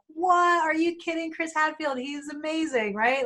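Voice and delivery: high squealing disbelief, like a starstruck fan; fangirling. Retold or imitated. Speaker hears Chris Hadfield is on next, starstruck fan squeal. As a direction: shriek it out in gushing fan delight. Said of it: "I don't tend to fangirl".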